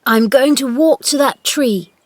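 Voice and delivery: high, loud resolve; determined boy. A narrator's reading. Boy telling Dickon his plan, determined. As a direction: declare it firmly.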